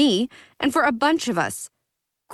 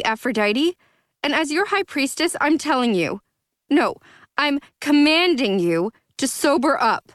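stern command; 8 semitones higher than usual. Acted. Zoey commands as high priestess.

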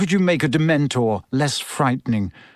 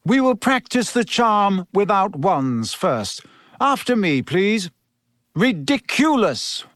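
higher-pitched, measured teacher's voice; Professor Lupin; 5 semitones higher than usual. Acted. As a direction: instruct the class with clear, drawn-out emphasis.

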